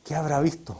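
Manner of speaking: murmured softly to the Lord; quiet prayer aside. Retold or imitated. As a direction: say it under your breath, wondering. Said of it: "Yo decía".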